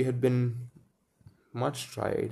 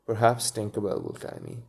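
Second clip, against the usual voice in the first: plain reading voice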